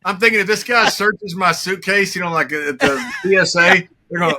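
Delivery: usual speaking voice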